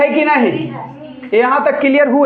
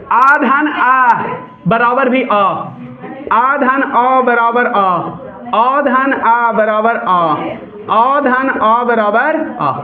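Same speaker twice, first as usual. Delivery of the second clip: slow dictation; much slower than usual. Shown as a demonstration. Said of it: "देखो, ये अ चार प्रकार से बना".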